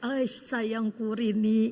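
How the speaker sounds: slow, dreamy mumble of a sleeping man, lightly voiced; sleep-talking husband. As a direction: murmur the name half-asleep, slow and soft.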